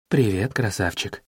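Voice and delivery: low, softened voice for a greeting. A narrator's reading. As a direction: drop the voice well down to say hello. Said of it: "спросила она низким голосом".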